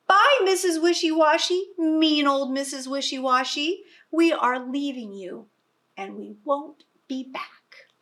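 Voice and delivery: cheeky parting call; defiant farewell. Acted. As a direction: jeer at the mean farmer as you go. Animals defiantly leaving.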